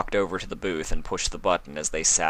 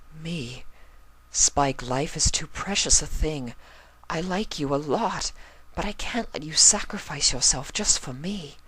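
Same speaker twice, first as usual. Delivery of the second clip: higher lady's voice, gently pleading; pleading Rarity; 9 semitones higher than usual. Acted.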